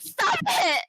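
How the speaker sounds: loud, very high squeal; retold panicked plea. Passenger pleading with raging driver, panicked. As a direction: re-enact begging him to stop.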